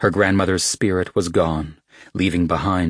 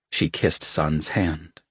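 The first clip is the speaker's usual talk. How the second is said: usual speaking voice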